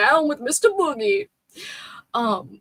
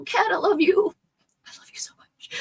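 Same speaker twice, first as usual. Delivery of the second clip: tender whisper; 10 semitones higher than usual. Real, not acted. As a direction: whisper lovingly to the cat. Cooing to her cat.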